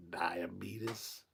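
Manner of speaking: low, hushed imitation; quiet, whispery impression; Wilford. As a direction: mimic the way he says die-uh-BEET-us. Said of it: "the way he said it".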